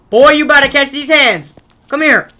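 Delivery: loud, high taunting shout; mock threat. Player taunting a chasing enemy, loud mock threat. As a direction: bark it like a bully.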